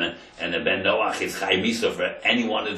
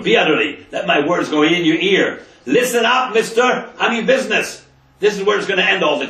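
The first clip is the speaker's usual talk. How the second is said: much higher, much louder commanding challenge; loud, high, strict warning to the viceroy; stern and strict; 11 semitones higher, 10 dB louder than usual. Retold or imitated. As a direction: confront a king as an equal. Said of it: "he spoke to him strict".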